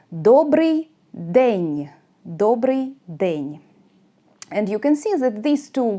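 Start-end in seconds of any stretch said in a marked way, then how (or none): 0.1-1.9 Добрий день drawn out very slowly; very slow model of Добрий день; slow pronunciation demo. Shown as a demonstration. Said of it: "де is more common". 2.2-3.6 Добрий день slowly, ending lower; slow closing repeat of Добрий день. Shown as a demonstration. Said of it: "де is more common".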